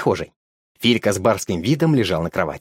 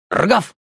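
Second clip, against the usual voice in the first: dog bark; 9 semitones higher than usual. Acted. Dog barks from downstairs.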